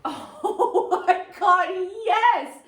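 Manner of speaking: loud, high, drawn-out laughing exclamation; laughing delight. Real, not acted. Guest hit by a painfully true remark, laughing delight. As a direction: burst out in delighted recognition. Reacts to joke about her.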